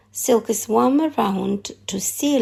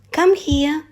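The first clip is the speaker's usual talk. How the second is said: higher, coaxing call to a bird; 7 semitones higher, 4 dB louder than usual. A narrator's reading. Nim coaxing the frigate bird down, gentle higher call. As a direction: lure him in gently, higher. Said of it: "she coaxed".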